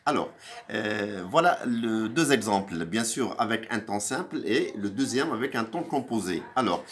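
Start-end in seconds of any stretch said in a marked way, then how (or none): none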